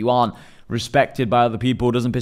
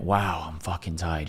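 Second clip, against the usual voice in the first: much softer, lower and slow, spent; exhausted; 6 semitones lower, 8 dB quieter, slower than usual. Retold or imitated. Runner sitting down after the race, worn-out murmur. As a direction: let it drain out of you after the finish line.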